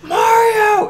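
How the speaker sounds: very high, loud shouted name; piercing high shout of Mario; third offscreen shout. Retold or imitated. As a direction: scream for Mario in alarm. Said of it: "you hear a third voice go".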